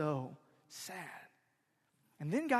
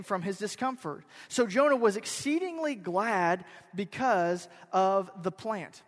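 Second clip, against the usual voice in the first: loud, measured Bible reading from the pulpit; scripture reading; 5 semitones higher, 8 dB louder than usual. Acted. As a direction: proclaim the verse to the congregation. Pastor reads scripture aloud.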